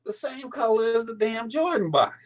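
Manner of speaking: high, laughing outrage at the wrong shoes; mock-outraged high voice; disbelief. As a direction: go high and incredulous, half laughing. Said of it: "I'm like".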